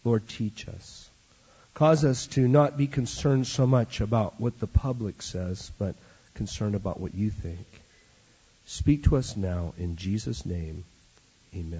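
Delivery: soft, low closing prayer; hushed prayer before the sermon. Real, not acted. Pastor praying softly over the congregation, hushed prayer.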